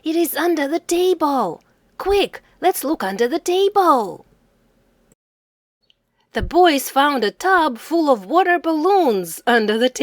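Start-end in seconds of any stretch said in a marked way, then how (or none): 0.0-4.2 higher-pitched, eager urging; keen child's cry, pitch lifted; excited. A narrator's reading. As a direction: urge them on with mild excitement. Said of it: "cried Max excitedly".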